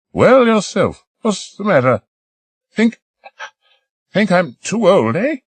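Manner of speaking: put-on high, quavering old woman's voice; elderly lady. Old lady volunteering to stay behind, high old voice. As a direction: play an eager elderly lady.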